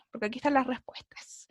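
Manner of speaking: secretive whisper about the answers. Real, not acted. Teacher whispering to students about hidden answers.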